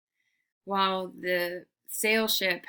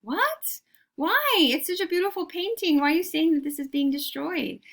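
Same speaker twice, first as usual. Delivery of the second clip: much higher, faster cry of mock disbelief; mock outrage; 8 semitones higher, 4 dB louder than usual. Teacher fakes dismay that the ship is scrapped. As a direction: play up the shock for the class.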